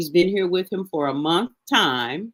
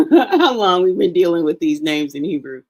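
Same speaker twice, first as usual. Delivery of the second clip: louder, breaking into a chuckle; real laughing; 3 semitones higher, 6 dB louder than usual. Real, not acted. Teacher waving off own backstory, laughing. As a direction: laugh through the start of the phrase.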